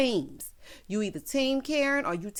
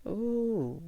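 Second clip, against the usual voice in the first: normal talking voice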